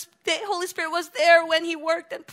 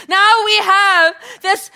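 loud, slower, earnest; urgent exhortation; 3 semitones higher, 8 dB louder than usual. Real, not acted. Preacher charging the church, loud and earnest. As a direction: press the burden on them.

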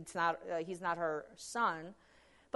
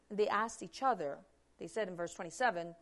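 ordinary talk

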